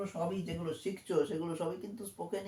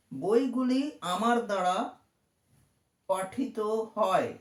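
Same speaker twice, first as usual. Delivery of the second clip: louder and very slow on পঠিত হয়; slow dictation; 3 semitones higher, 5 dB louder, slower than usual. Shown as a demonstration. Teacher slowly translating for young students. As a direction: stretch it out clearly for the class. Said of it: "মানে".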